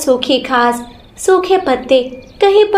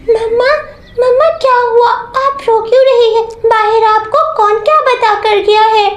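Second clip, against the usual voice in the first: put-on worried little girl, higher voice; a small child anxiously asking her mother; 8 semitones higher than usual. Daughter asking her weeping mother what happened, small child voice.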